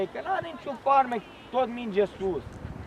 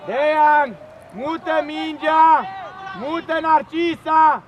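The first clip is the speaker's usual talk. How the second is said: coach shout; 2 semitones higher, slower than usual. Real, not acted. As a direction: call it across the field, slowly.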